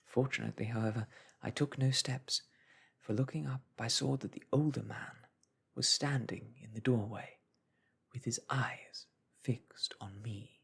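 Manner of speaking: ordinary delivery, nothing marked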